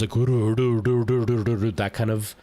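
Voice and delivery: a slow, drawn-out trilled R before "that kind of"; rolled R demo. Shown as a demonstration. Showing a friend how Japanese rolled Rs sound. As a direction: roll the R long and slowly. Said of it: "like, rolled R's".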